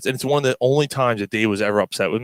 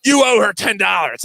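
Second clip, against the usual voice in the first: louder, higher retold shout; mock angry demand; 6 semitones higher, 4 dB louder than usual. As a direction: bark the demand like a fed-up friend. Angry friend demanding payment.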